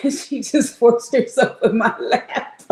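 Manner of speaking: laughing while talking. Real, not acted. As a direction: giggle through the words.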